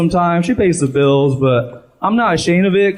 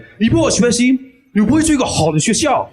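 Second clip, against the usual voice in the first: stern lecturing father, higher and faster; strict Chinese dad; 9 semitones higher, faster than usual. Retold or imitated. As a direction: scold your kid like a strict dad. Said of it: "a really strict Chinese guy who would lecture me in Chinese".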